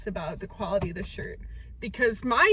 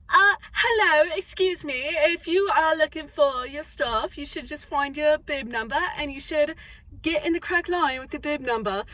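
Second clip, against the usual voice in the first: lightly imitated British accent, a bit higher; mock British accent; 4 semitones higher, 3 dB louder than usual. Vlogger imitating British race staff in a light British accent. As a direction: put on a polite British staff voice. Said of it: "there's British people running the whole thing".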